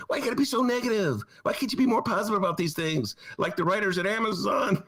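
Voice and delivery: high, nagging complaint; mock a scolding local; whiny mock Chicagoan. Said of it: "typical Chicago reaction is".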